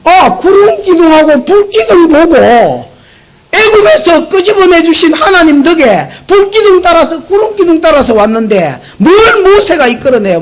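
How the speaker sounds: high, loud preaching cry; fervent preaching. Acted. Preacher crying out to the congregation, high and loud. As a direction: cry it out with fervour.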